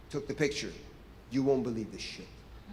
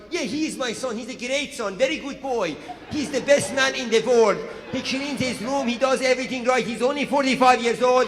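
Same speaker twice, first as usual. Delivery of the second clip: higher, bragging Persian mom voice, getting louder; 4 semitones higher, 3 dB louder than usual. Retold or imitated. Mom bragging about her son to a guest, higher and louder. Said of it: "they start talking for the son".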